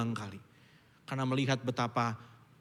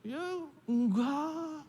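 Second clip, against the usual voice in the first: high mock denial; 12 semitones higher, much slower than usual.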